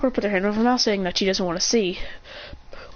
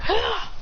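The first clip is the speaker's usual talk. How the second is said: high battle cry; 11 semitones higher than usual. Acted. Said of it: "He goes".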